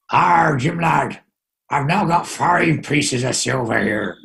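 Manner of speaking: slow mock pirate, Arr stretched out; Johnny Depp pirate. Host keeps the pirate act going for the guest, slow mock pirate. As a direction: growl it like a stage pirate. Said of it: "my Johnny Depp impression".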